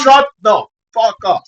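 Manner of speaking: loud, slow, high yell; shouting. Real, not acted. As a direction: bark it to shut him up. Yelling at co-host to stop.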